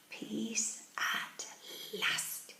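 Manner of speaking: soft, whispery, relieved words; murmured to himself. A narrator's reading. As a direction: whisper it slowly to yourself. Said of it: "he said to himself".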